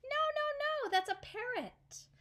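little monkey squeals no, no, no at the parrot; monkey protesting. Acted. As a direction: squeal out a frantic denial.

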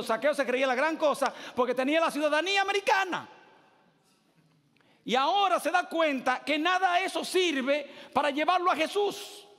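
high, loud rising sermon build; fiery preaching. Acted. Pastor tells the Zacchaeus story to the church, fiery.

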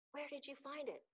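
high-pitched astonished question; breathless question. A narrator's reading. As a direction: ask it in breathless wonder. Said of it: "asked Unhei breathlessly".

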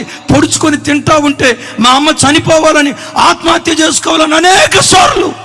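fiery sermon crescendo; impassioned preaching. Acted. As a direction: rail against the cruel gossip. Impassioned preaching about relatives' scorn.

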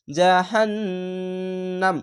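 "জাহান্নাম" with long hummed n, closed on m; joined, stopped ending. Shown as a demonstration. Teacher recites the word for learners, humming then stopping. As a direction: hold the nn, then stop on নাম. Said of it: "এভাবে মিলিয়ে থেমে যাবেন".

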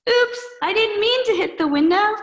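high, loud cartoon kid voice; a clumsy kid yelping in dismay; squeaky Klutzy Boy.

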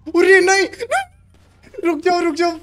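high, loud plea to halt; urgent shouting. Pretend. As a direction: shout out a frantic time-out. Urgent call to halt in game.

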